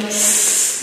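long, soft hissing sss; snake hiss. Shown as a demonstration. Teacher showing the snake's sound, long hiss. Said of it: "A snake makes sound".

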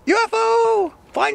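high, very loud playful yell. Shown as a demonstration. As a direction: holler it like a gleeful sighting. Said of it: "I can yell out".

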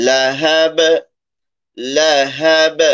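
লাহাব ending with a bouncing echo on ব; with qalqala echo. Shown as a demonstration. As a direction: let the ব of লাহাব rebound. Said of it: "প্রতিধ্বনি দিয়ে উচ্চারণ করব". (কলকলা দিয়ে লাহাব)

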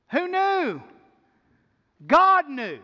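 high, emphatic preacher's cry; triumphant exclamation. Real, not acted. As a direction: ring it out loud as the punchline.

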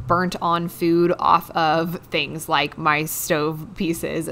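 normal talking voice

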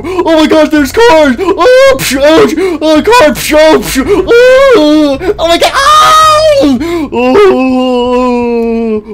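high, loud crying yelps; panicked yelps. Acted. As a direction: yelp in pain and panic. Bird crashing into cars.

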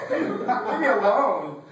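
high, loud, drawn-out mock wail; exasperated outburst. Young man fending off old church people, exasperated. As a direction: wail it slowly, playing up the exasperation.

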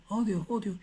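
echo. Retold or imitated. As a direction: let it fade away. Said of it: "Así decía ... el eco".

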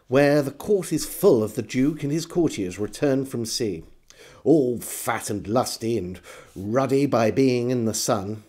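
ordinary delivery, nothing marked

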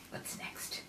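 hushed whisper. Acted. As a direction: whisper it like a secret. Whispered page turn.

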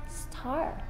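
put-on soft hint for the child; whispered prompt. Shown as a demonstration. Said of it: "it's a".

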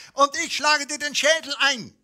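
high, loud squeak of a tiny egg. A narrator's reading. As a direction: squeal the threat like a cocky egg. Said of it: "quietschte das Ei".

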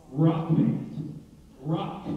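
"rock" set apart by a pause and punched; stress on rock. Shown as a demonstration. Preacher describing Skillet to the church, emphasis on "rock". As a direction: lean on "rock" as a word on its own. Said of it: "Emphasis on the rock".

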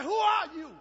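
high, loud cry of amazement; preacher acting out a stunned boy; astonished retold question. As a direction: shout the question in wonder.

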